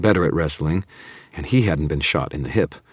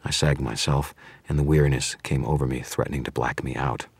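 ordinary delivery

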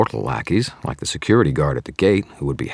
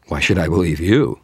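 louder retort with stress on you; countering; 2 semitones higher, 4 dB louder than usual. Acted. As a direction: throw the question back at her. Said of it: "I countered".

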